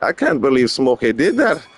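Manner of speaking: imitating a friend's Scottish accent. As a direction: do a tipsy friend's Scottish act. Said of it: "start talking like that".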